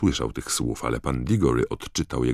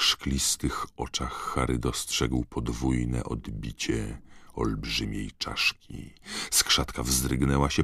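ordinary delivery

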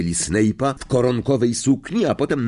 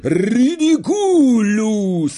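very long, high wail of an incantation; loud soaring howl; howled spell; 11 semitones higher, 5 dB louder, much slower than usual. A narrator's reading. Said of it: "zawył Ron".

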